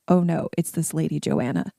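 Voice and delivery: ordinary talk, nothing marked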